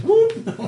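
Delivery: high-pitched, loud "whoop!"; sudden falsetto whoop; excited whoop. Retold or imitated. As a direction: imitate an excited little yelp. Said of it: "he says".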